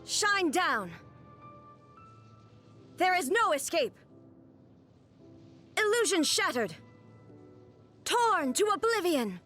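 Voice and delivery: high, commanding attack call; ringing high battle cry with falling ends. Acted. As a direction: declaim it like a finishing strike. Game character's attack battle cries.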